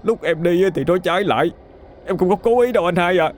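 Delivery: high, tearful pleading; crying, begging. A narrator's reading. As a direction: beg your brother for mercy, sobbing. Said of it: "sợ hãi bật khóc mà cầu xin". (khóc lóc van xin anh hai, giọng cao)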